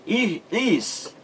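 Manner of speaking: i-, is as two separate high beats; isolated stressed syllable. Shown as a demonstration. Teacher naming the sílaba mais forte, high isolated i-, is. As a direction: pick out i-, is high and on its own. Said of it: "é a sílaba mais forte".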